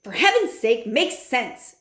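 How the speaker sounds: mild mock shout from the father. A narrator's reading. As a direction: bark it out impatiently. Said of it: "shouted Mister Jenkins".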